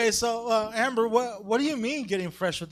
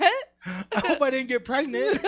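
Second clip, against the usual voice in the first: mock naive girl; 5 semitones higher, 4 dB louder, slower than usual. Mock naive eleven-year-old.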